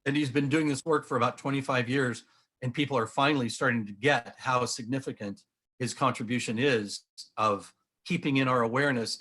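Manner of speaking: ordinary talk, nothing marked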